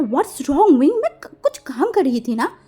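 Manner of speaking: angry reply. A narrator's reading. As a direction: snap back at him, annoyed. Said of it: "गुस्से में बोला". (गुस्से में जवाब)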